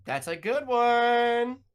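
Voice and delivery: high, slow mock praise; sarcastic. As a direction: drag out the fake compliment. Mock praise of strong play.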